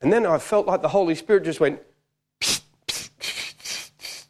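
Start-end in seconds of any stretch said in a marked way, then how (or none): 2.4-4.2 sharp voiceless psst, repeated; whispered psst. Retold or imitated. Holy Spirit prompts the preacher, whispered psst. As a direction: hiss for attention into someone's ear. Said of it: "start whispering in my ear".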